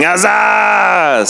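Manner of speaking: long, high, projected holler; loud guy's shout. Acted. Performer tells fans how to find him, hollering. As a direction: stretch the catchphrase out loudly. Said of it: "the sound of the loud guy".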